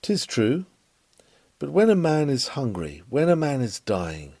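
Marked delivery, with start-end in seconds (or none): none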